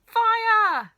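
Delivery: grandma yelling in panic about the fire; yelled. A narrator's reading. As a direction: bellow it like an alarm. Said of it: "the old woman yelled".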